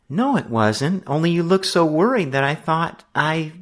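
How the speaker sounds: high, voice cracking at the end; voice breaking. A narrator's reading. Sister trying to explain, voice breaking. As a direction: plead until the voice breaks. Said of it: "her voice broke".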